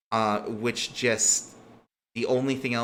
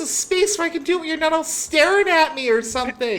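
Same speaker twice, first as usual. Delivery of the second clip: very high, loud, rapid protest; indignant demand; 8 dB louder, faster than usual. Acted. Girl demands privacy from onlookers, high, loud and indignant. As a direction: burst out in exasperated outrage.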